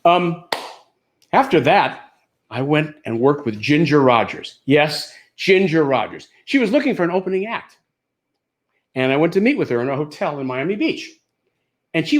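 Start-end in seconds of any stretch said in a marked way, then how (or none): none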